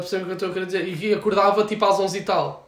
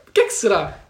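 laughing; 7 semitones higher, 10 dB louder than usual. Real, not acted. Laughs at the unhealthy food.

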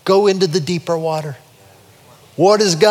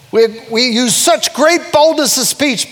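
higher, slow, emphatic scripture reading; bold proclamation; 6 semitones higher, 3 dB louder, slower than usual. Real, not acted. Preacher repeats Paul to the congregation, bold proclamation. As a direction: proclaim the verse with boldness.

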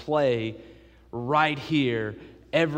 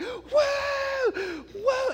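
1 whoa cheer. Retold or imitated. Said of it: "we were like".

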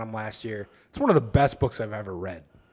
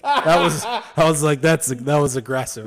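laughing retelling; 3 semitones higher, 10 dB louder than usual. Real, not acted.

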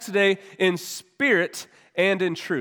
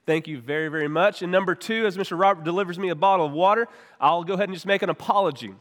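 normal talking voice

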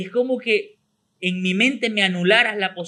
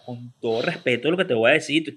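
lower-pitched, mock-solemn preamble; pompous, hand on chest; 7 semitones lower than usual. Shown as a demonstration. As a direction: announce it with ceremonious gravity. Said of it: "Manito en el pecho y pico parado". (solemne y ceremonioso, con pico parado)

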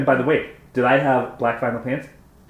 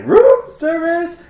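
put-on very high, slow German-accented room service; high slow German caller voice; German-sounding voice; 8 dB louder, much slower than usual. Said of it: "And she's like".

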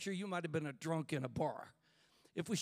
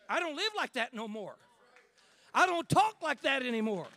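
bold declaration; 9 semitones higher, 9 dB louder than usual. Pretend. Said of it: "empower you to say".

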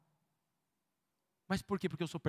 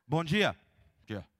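sullen, curt hello; grumpy greeting; 5 semitones lower, 4 dB louder, slower than usual. Retold or imitated. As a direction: mutter it like you just woke up. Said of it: "Entra assim com cara amarrada".

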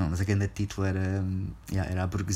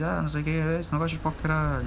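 higher, a bit faster mock bravado; his cocky tone; 9 semitones higher than usual. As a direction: play the tough guy on stage, pitched up. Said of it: "mas é esse tom".

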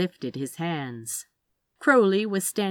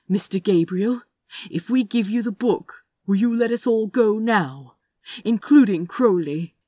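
loud, raised, deliberate demand; firm negotiation; 4 semitones higher, 6 dB louder than usual. A narrator's reading. Gunman bargains with Gabriel for everyone's release, firm and loud. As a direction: press the question firmly, voice up.

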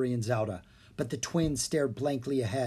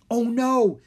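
high, loud dismay; alarmed; 9 semitones higher, 8 dB louder than usual. A narrator's reading. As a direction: gasp it out in alarm. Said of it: "he said".

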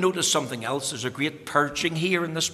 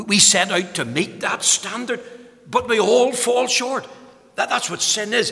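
higher and louder, driving the phrases; full-force preaching; 7 semitones higher, 4 dB louder than usual. Acted. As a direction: proclaim eternity with fervour. Preaching build on falling short.

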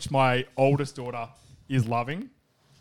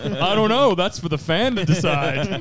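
usual speaking voice

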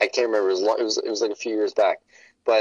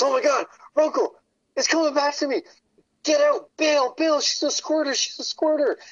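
much higher, hammed-up frantic alarm; high mock-panicked shout; panicked Hank impression. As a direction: squeal out the warning in mock panic. Hank panicking, warning Runkle.

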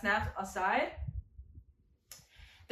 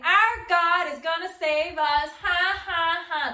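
louder, slow, gloating put-on voice with a mock laugh; 7 semitones higher, 6 dB louder, slower than usual. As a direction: gloat with a fake ha ha ha. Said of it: "They're not saying".

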